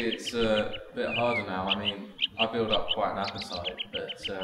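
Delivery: ordinary talk, nothing marked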